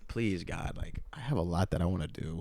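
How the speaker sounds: a hushed plea whispered under the breath; silent prayer. Retold or imitated. Praying on the descending plane, hushed plea. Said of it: "just thinking to myself".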